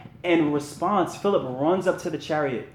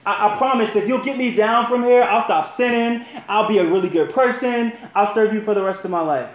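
higher, louder desperate bargaining; frantic pleading; 5 semitones higher, 5 dB louder than usual. A narrator's reading. Jack dangling from the branch begs God, frantic and loud. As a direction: beg and promise in a rush.

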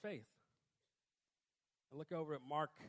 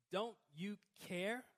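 slow plea; slower than usual. Pretend. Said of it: "it's easy to look and say".

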